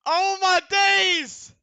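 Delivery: loud, high, drawn-out cry; shocked shout. Real, not acted. Still reeling from jump scare.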